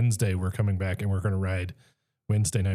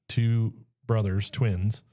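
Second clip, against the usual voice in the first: ordinary delivery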